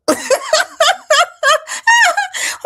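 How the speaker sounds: big high whooping laugh; loud laugh. Real, not acted. Laughing at her own troll joke, a big loud laugh.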